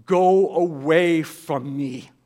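slow, loud, drawn-out plea as Peter; anguished plea. Acted. Peter on his knees begging Jesus, slow loud plea. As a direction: beg him to go away, each word stretched.

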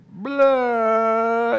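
loud, high, long bawl; hammed-up wail like a two-year-old; mock toddler bawling. Shown as a demonstration. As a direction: imitate an ugly snot-bubble cry. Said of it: "like snot bubble, two-year-old kid".

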